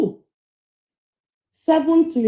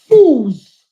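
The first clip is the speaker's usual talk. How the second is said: angry fools; 7 dB louder than usual. Real, not acted. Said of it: "[name] sounds like she got a lot of passion".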